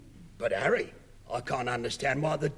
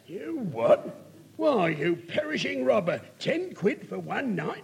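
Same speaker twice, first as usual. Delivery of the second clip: outraged; 7 semitones higher than usual. Acted. Outraged at the tenner demand.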